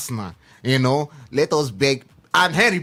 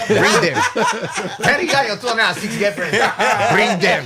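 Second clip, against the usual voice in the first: louder, rallying bring them; rallying call; 6 semitones higher, 3 dB louder than usual. Real, not acted. Inviting fans to the watch party, rallying call. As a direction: call out to the crowd.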